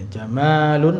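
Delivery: jamalun with a long, held "maa"; long vowel. Shown as a demonstration. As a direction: hold the middle vowel of jamalun. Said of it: "Kalau panjang".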